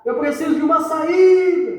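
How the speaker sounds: full-voiced yell for a way out; acted shout. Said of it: "Aí você vai gritar lá".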